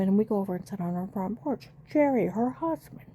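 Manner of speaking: ordinary talk, nothing marked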